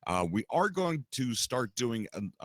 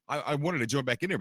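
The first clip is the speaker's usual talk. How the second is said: normal talking voice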